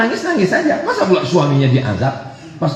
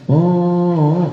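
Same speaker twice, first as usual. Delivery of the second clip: half-hearted mock wail; drawn-out, restrained fake crying "oh"; tangisan setengah. Shown as a demonstration. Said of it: "Kalau nangisnya". (ratapan pura-pura tanggung)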